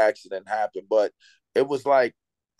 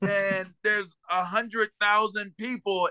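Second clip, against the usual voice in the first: slow motion; 7 semitones higher, slower than usual. Pretend. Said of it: "the best slow motion impression".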